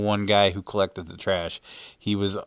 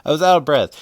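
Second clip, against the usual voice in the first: higher, louder, laughing through it; 7 semitones higher, 9 dB louder, much faster than usual. Real, not acted. Admitting to viewers he was out of breath, laughing. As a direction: let the laugh break into the words.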